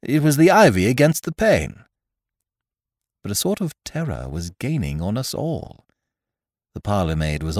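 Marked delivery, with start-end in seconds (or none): 0.0-1.8 high, insistent cry; raised, emphatic exclamation. Acted. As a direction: cry it out as urgent reassurance. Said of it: "I cried".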